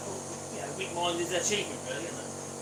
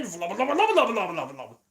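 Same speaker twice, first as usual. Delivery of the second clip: flowerpot gibberish; 10 dB louder, faster than usual. Pretend. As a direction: babble like a flowerpot man puppet. Bill and Ben gibberish voice.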